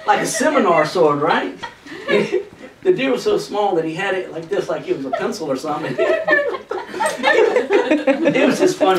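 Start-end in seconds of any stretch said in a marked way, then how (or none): 6.0-8.3 falling high burst of laughter. Real, not acted. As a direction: laugh high and let it fall. Laughing at own story.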